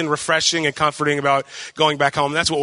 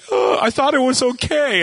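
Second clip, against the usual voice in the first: higher-pitched, imitated weepy protest; mock tearful; 7 semitones higher than usual. Worshipper caught off guard by tears, mock tearful. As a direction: act out bursting into tears mid-sentence. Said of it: "I'll just start crying".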